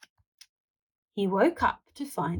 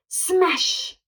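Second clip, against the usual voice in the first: crash sound; 9 semitones higher than usual. A narrator's reading. Wall crashing.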